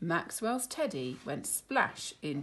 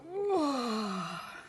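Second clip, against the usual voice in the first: sleepy sigh; 7 semitones higher than usual. Acted. Sleepy Boris.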